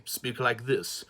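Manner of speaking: lightly put-on Cockney; amateur Cockney impression; Cockney accent. Shown as a demonstration. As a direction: slip into a mock Cockney accent. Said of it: "normally he's like a Cockney man".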